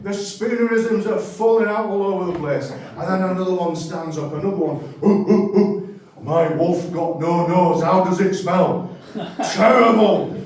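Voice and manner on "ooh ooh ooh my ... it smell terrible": caveman joke. Acted. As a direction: hoot like an ape, then land the pun. Caveman telling wolf joke.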